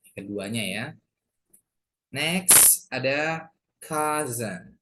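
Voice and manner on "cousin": cousin said slowly as "KAH-zen". Shown as a demonstration. Teacher introducing a new word, cousin with a z. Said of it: "Jadi S-nya jadi Z ya".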